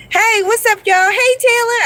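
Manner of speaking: high, bright hello to a newcomer; excited greeting. Real, not acted. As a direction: sing out a high greeting to a friend arriving. Greeting a viewer who joins.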